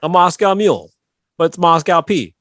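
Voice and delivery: ordinary talk, nothing marked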